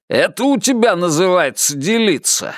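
heated accusation without shouting; barked reproach. A narrator's reading. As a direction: throw it back as an indignant challenge. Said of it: "рявкнул тренер Уитсток".

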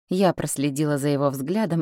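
usual speaking voice

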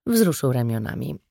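plain reading voice

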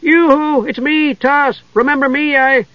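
high, loud yoo-hoo greeting; hooting call. A narrator's reading. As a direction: hoot across the room like an excited child. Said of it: "waved and hooted".

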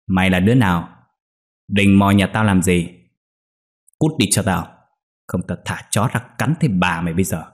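understated threatening rebuke; mild, narrated telling-off; angry scolding. A narrator's reading. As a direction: scold an intruder without really shouting. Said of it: "Lão thấy thế liền đi đến quát".

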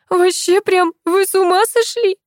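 put-on high, indignant girl's squeal. A narrator's reading. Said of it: "визжала девочка". (возмущённый девчачий визг)

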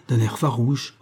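plain reading voice

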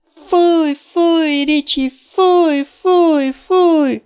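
put-on very high, loud childish jeer; high squealing child voice. A narrator's reading. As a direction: squeal it like a kid booing a friend. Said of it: "magas gyerekhangon sipította". (magas, sipító gyerekhangon)

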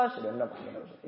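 a quick, soft whispered reply; whispered fast reply. Shown as a demonstration. Preacher whispers the listener's answer to the azan. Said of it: "Itu metode pertama".

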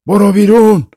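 high, loud shouted order. A narrator's reading. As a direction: shout them out of the house. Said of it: "با صدای بلند گفت". (با صدای بلند و فریاد)

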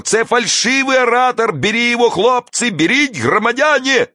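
high agitated crowd agitator; very high, thin cry; thin tenor shouting. A narrator's reading. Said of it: "второй тонкий тенор".

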